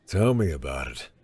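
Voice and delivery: put-on lightly gruff older man; a hint of a gruff welder. A narrator's reading. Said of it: "a gruff voice said".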